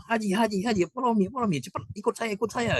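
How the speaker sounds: urgent calling. Retold or imitated. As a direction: hurry the pilgrim along. (memanggil dengan tergesa-gesa, suara sangat tinggi)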